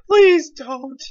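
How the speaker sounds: whiny plea; pleading. Pretend.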